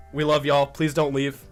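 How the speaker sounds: usual speaking voice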